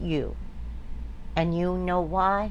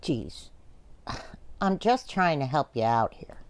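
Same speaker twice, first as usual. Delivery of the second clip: usual speaking voice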